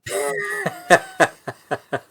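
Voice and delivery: high burst of real laughter; big laugh. Real, not acted. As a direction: player bursting out laughing at the barkeep. Player laughs at barkeep's shooing.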